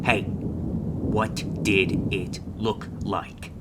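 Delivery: very slow, word by word; slow insistent repeat. Acted. Mentor to an unresponsive rookie, slow insistent question. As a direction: spell the question out slowly.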